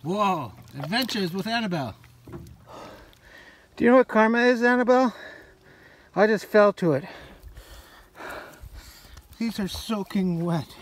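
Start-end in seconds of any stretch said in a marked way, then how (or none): 3.8-7.0 louder, higher frustrated yell; loud exasperated call. Real, not acted. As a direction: shout at the lion in mock blame. Yelling at Annabelle after fall.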